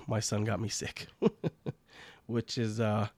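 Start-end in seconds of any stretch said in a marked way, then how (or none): 0.1-1.1 soft chuckle through the words, quieter; laughing it off to his viewers; laughing softly. Real, not acted. Explaining his cold to viewers, laughing quietly. 1.1-1.7 quick high chuckle; a brief amused laugh; short laugh. Real, not acted. Laughing to viewers about his son's cold, a short chuckle.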